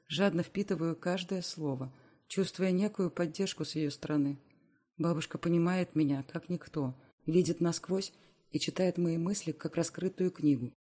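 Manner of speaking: plain reading voice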